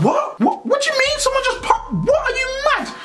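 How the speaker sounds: high incredulous What; shocked disbelief. Retold or imitated. Acting out shocked reaction.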